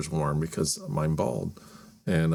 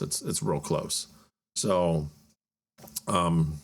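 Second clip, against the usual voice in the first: ordinary talk, nothing marked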